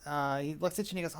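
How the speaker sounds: ordinary talk, nothing marked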